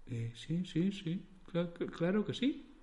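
soft, faltering stammer; soft, slow, stammered yes. Acted. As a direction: stumble over the words, caught off guard. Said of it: "acertó a balbucear Lucas".

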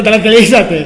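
loud, high call to calm down; calming shout. Real, not acted. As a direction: shout him down to settle him. Calmando a Tomás a gritos. (grito alto para calmarlo)

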